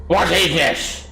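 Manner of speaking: high, slow creature voice; startled gnoll. Acted. Gnoll sees everyone behind the illusion, high creature voice. Gnoll discovers the hidden party.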